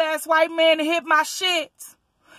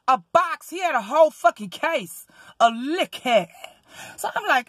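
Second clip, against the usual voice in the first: normal talking voice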